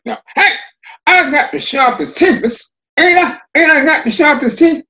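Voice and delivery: high, loud boastful fox voice; bragging Fox. Acted. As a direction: crow about it high and loud. Fox brags at the river.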